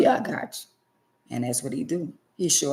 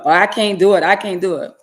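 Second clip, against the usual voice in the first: whiny mock of lazy children, louder; hammed-up whine about not being able to; mocking whiny excuse; 3 semitones higher, 8 dB louder than usual. Mocking people who refuse to help.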